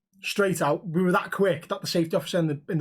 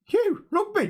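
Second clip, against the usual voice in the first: disbelieving, much higher "You, rugby?"; incredulous; slower than usual. Retold or imitated. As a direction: pitch shoots way up on rugby. Doubting the lad's rugby claim.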